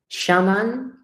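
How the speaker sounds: normal talking voice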